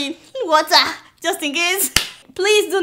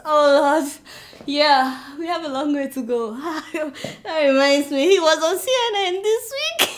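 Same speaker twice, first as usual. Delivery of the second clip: ordinary talk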